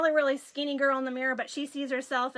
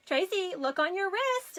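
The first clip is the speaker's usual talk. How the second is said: higher-pitched mom quote; mom's reminder; 6 semitones higher than usual. Retold or imitated. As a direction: imitate a mother prompting her daughter. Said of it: "And my mom".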